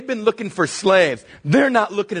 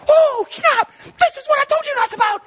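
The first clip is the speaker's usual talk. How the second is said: excited mock shout. Joseph seeing his dream fulfilled, squealing excitedly. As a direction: cheer like a kid whose dream came true. Joseph gloating at bowing brothers.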